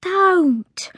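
high, pleading little maid; upset scullery maid's plea; distressed. A narrator's reading.

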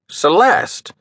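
high, delighted call of her name; glad exclamation. A narrator's reading. Captain recognizing the naga, delighted. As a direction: greet her with surprise.

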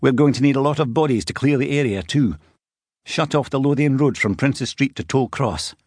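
tense police officer phoning in a major accident; McLean on the phone. A narrator's reading. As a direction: alert cop reporting a crash to control. McLean giving orders.

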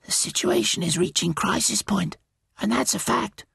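high, creaturely bird voice; caterbird voice. Acted. Caterbird telling Twig of crisis, high bird voice. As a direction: speak high, like a solemn talking bird.

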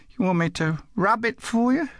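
put-on high child's voice asking; little girl. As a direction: ask Dad in a high small-girl pitch. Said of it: "The little girl".

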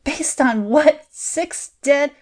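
barely forcing it through a tight throat; choked out. A narrator's reading. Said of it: "She choked out".

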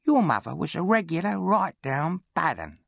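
high, needling bully's voice; taunting charity boy. A narrator's reading. Charity boy aggravates the orphan at dinner, high taunting.

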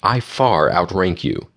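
plain reading voice

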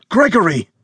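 high shocked shout of a name; yelled. Acted. Bernard seeing his bloodied friend collapse, alarmed shout. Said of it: "Bernard yelled".

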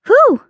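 high loud exhale; loud tired whew. Acted. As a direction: blow out a weary whew. Tired after hard work.

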